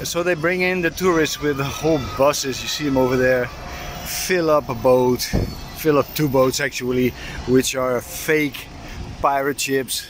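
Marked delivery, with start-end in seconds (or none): none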